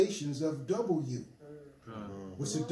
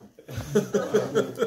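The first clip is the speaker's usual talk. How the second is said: hearty laugh; 5 semitones higher, 6 dB louder than usual. Real, not acted. Laughing at his own point.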